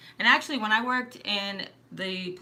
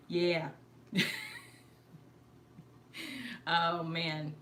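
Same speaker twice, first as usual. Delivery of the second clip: usual speaking voice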